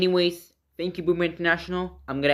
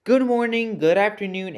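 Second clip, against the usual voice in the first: bright greeting; 6 semitones higher, 5 dB louder than usual. Real, not acted. Cheery greeting to viewers.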